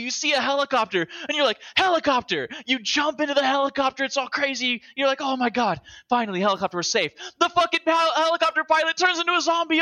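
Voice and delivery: breathless hype about the game; excited rant. Real, not acted. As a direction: pile on the chaos, voice climbing. Excited recap of game chaos.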